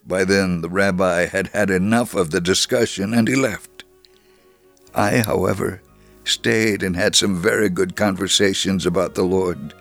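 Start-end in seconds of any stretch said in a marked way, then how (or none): none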